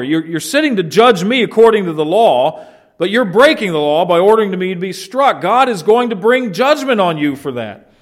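indignant preacher's rebuke; stern rebuke. Retold or imitated. Paul rebuking the high priest.

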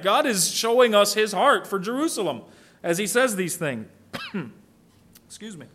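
usual speaking voice

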